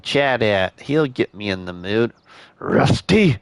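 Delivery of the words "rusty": put-on old man voice, cut short; trying to find the old man voice; grandpa voice. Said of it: "the grandpa voice is breaking through".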